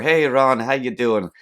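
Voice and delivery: bad, high, loud Scottish-Irish accent for a puppet; a clumsy mix of Scottish and Irish accents; horrible Scottish-Irish accent. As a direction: ham up a botched Scottish-Irish greeting. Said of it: "did this horrible Scottish slash Irish slash I don't know how to do accents accent".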